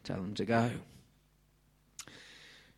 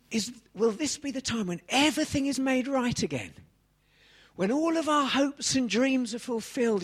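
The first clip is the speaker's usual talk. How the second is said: eager disciples; 12 semitones higher, 4 dB louder than usual. Acted. Voicing the disciples' hopes.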